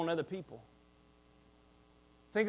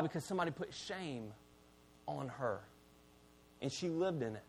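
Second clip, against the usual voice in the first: hushed; 6 semitones lower, 12 dB quieter than usual. Real, not acted. Sermon climax, dropping to hush.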